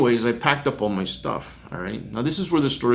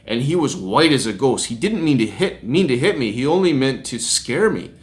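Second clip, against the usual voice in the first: normal talking voice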